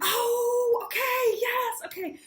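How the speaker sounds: very high, loud drawn-out "oh"; hammed-up penny-drop moment; acted-out realization. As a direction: suddenly get it, squealing with relief. Acts receptionist suddenly understanding.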